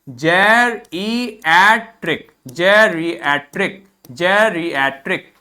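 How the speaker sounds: जेरिएट्रिक said very slowly, three times; slow model pronunciation. Shown as a demonstration. Teacher models the adjective slowly for the class. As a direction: drill जेरिएट्रिक slowly for repetition. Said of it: "इसका pronunciation देखिए".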